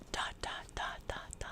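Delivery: hushed mysterious whisper. Pretend. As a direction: breathe the dots out softly. Whispered trailing title ending.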